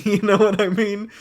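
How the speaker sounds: laughing. Real, not acted. Laughing at the odd impression.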